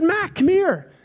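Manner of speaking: high-pitched fatherly call; calling over. Retold or imitated. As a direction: call a child over, voice lifted. Dad calling his daughter over.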